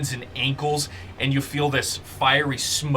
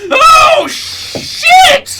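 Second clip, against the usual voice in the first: very high, loud comic shriek; startled scream; 14 dB louder than usual. Acted. Snake gets bellowed at in his head, shrieking scream. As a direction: shriek as if blasted inside your head.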